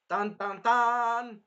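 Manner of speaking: slow, loud, high dun dun dun; mock ominous music cue; dramatic sting. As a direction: sing a villain-arrival sting. Dramatic sting for villain entrance.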